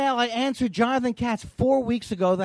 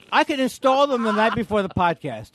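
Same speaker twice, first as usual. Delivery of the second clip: ordinary talk, nothing marked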